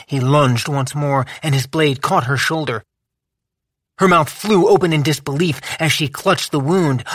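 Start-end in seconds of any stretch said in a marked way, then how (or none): none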